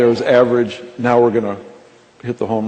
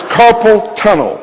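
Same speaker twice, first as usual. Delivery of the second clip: slow, high, stretched-out call; intercom announcer; 5 semitones higher, slower than usual. Retold or imitated. Young man calls out ailments on the store intercom, announcing. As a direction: imitate someone calling an ailment over the PA. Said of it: "he takes the mic back and he says".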